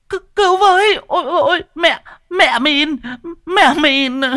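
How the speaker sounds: very high, sobbing wail; terrified cry for help. A narrator's reading. Woman pinned to the ground, sobbing for help. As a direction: wail in broken falsetto, drawing words out.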